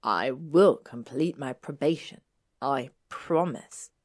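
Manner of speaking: firm vow, slow on "I promise"; deliberate. A narrator's reading. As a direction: press each word of the vow home. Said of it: "he said, looking directly at her".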